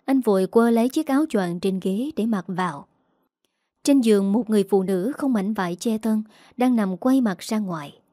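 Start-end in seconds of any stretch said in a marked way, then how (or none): none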